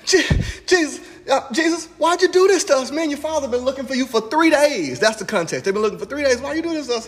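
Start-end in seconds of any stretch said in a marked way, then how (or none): 0.0-5.0 high-pitched frantic mother scolding her son; Mary panics after searching three days for Jesus. Retold or imitated. 6.4-7.1 high mock mother asking why; mother scolding the boy Jesus; worried mother. Mother voiced again.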